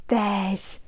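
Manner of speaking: usual speaking voice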